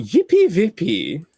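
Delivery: slow silly mock German chant; drawn-out fake German; mock German accent. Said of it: "you have to go".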